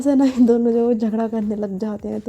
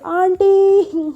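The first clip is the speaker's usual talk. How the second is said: long, high drawn-out shout toward the door; loud yell; 9 semitones higher, 5 dB louder, much slower than usual. Acted. Kashish calling the aunt from the doorway, long loud yell. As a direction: stretch the call out loud to summon her. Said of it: "ज़ोर से चिल्लाती कहती है".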